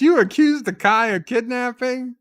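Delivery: high, loud incredulous bark of an angry captain; Sisko shouting. Retold or imitated. Sisko confronting Jake and Nog, high loud outraged shout. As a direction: blast it out like a furious commanding officer. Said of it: "we cut to Sisko and says".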